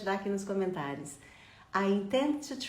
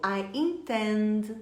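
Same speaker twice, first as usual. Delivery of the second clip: I intend, drawn out slowly; slow repeat-after-me I intend; slow model phrase; much slower than usual. Shown as a demonstration. Teacher drilling I intend for students. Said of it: "Já treina comigo".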